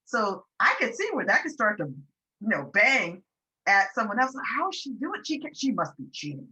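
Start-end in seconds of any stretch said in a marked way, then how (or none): 4.6-6.5 jealous colleague. Retold or imitated. Imagined jealous colleague's inner voice.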